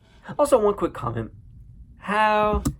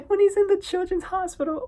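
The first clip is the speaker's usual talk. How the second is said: squealing through laughter, very high; cracking up; 4 dB louder than usual. Real, not acted. Recalling the hospital scene, cracking up. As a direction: laugh helplessly while telling it.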